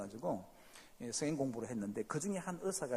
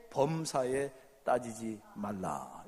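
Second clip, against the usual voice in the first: drawn out like holy scripture; mock scripture, slow and weighty; solemn slow reading; 4 semitones lower, much slower than usual. Joke verse repeated solemnly. (느릿하게 성경 읽듯 엄숙하게)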